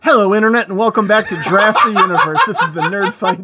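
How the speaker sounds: big loud podcast opener, laughing; loud laughing intro; loud show intro. Real, not acted. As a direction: announce the show at full volume.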